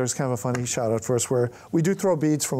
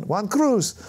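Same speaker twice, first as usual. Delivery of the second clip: put-on kid answering; 7 semitones higher than usual. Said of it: "young man".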